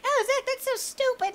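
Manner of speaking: high, squeaky mock viewer voice. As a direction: whine it like a sneering fan. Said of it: "You guys might think".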